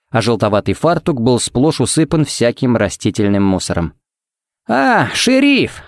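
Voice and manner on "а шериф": high, drawn-out happy greeting; delighted greeting. Acted. Pharmacist to the visiting sheriff, delighted greeting. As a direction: greet them with delight, pitch leaping up. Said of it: "обрадовался он".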